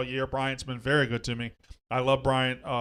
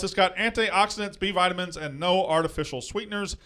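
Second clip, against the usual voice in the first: upbeat, higher sponsor-read voice; 5 semitones higher than usual. Acted. Host reading the sponsor ad to listeners, pitchy and upbeat. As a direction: sell the drink like a radio spot.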